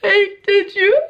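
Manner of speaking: very high, slow, squealed wail; drawn-out mock happy crying; hammed-up bawling. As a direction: re-enact sobbing to friends in disbelief. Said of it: "I was full on crying".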